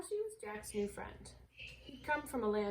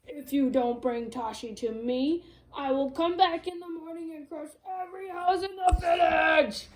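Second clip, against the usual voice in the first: loud menacing giant shout; threatening giant; 7 semitones higher, 9 dB louder than usual. Acted. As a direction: threaten to crush the village.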